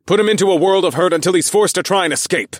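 fast, loud command. A narrator's reading. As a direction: rap out the order quickly. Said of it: "I commanded".

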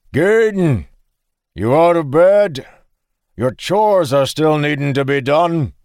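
high, loud call across the farm; yell. Acted. Farmer yells to his son to do chores. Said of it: "The man turned and yelled".

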